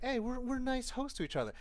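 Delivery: high, sugary pretend-nice voice; mock friendliness. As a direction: put on a high, falsely friendly voice. Mimics fake friendliness before backstabbing.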